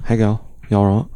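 attempted Scouse accent; Liverpool accent. Shown as a demonstration. Host demonstrating accents to co-host, Liverpool accent. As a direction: have a go at a Liverpool greeting. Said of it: "Liverpool's like".

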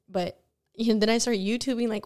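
ordinary delivery

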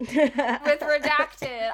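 censor bleep tone over words; words covered by a bleep. Real, not acted.

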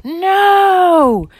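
loud, high drawn-out shout; shouted refusal. Acted. Parents refusing from downstairs, shouting up. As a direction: holler it up the stairs. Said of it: "the parents shout up".

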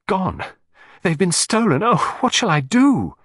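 high, upset and near tears; tearful distress. A narrator's reading. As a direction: plead in despair over the stolen miniatures. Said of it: "large tears standing in her eyes".

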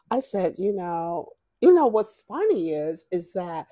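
usual speaking voice

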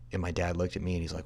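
usual speaking voice

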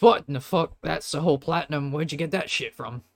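loud, high burst of disbelief, easing off; stunned shopkeeper exclaiming; astonished outburst. Acted. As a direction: blurt it out, floored by the money. Shopkeeper astonished by platinum coin.

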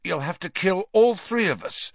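high, insistent defiance; defiant. A narrator's reading. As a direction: dare him to kill you all. Injured Ron defies the killer.

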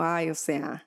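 lightly mocking fresa "Ay, o sea"; imitating the old fresa "o sea"; fresa accent. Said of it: "antes era como".

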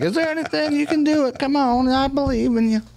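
put-on old lady encouraging the helpless men, in a high sweet voice. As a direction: cheer him on like a kindly old lady. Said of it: "she was like".

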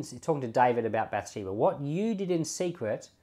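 ordinary delivery

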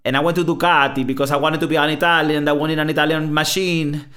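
hammed-up Italian accent. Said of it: "he's like".

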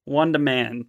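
ordinary delivery

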